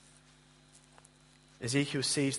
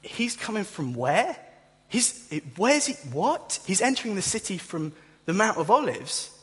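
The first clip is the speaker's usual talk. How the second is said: incredulous surprise; 6 semitones higher than usual. Retold or imitated. Ancient hearer startled by the news, climbing in disbelief. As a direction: pitch jumping higher, voice climbing in disbelief. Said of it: "You'd be".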